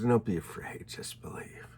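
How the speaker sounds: tender whispered comfort; a gentle hushed "just believe"; reassuring whisper. Acted. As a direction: whisper it to calm a grieving father. Jesus comforts the grieving father.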